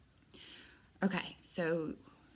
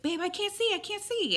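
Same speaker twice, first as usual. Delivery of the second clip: high-pitched, mock panicked call; imitated alarm. Boyfriend interrupts her complaining, imitated high alarm. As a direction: cry out for help as your partner, pitched way up.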